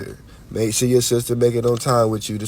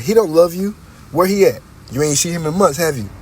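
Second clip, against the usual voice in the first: higher, louder mocking mother; mother's taunt; 6 semitones higher, 4 dB louder than usual. Son imitates his mother taunting him about his dad, higher and louder. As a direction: needle the kid, louder and higher. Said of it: "she used to be like".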